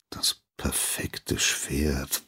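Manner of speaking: slow unvoiced whisper to himself; pondering whisper. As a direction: mull it over under the breath. Apprentice mulls the question.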